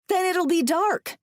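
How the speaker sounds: high-pitched impatient retort; snapping. A narrator's reading. Woman refusing to abandon trapped companion, snapping. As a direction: snap back at him. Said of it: "I snap".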